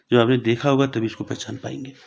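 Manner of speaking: ordinary talk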